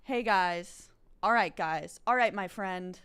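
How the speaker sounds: loud hyped streamer greeting; Mason impression. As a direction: belt the greeting like a hyped host. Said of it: "that's how it's done".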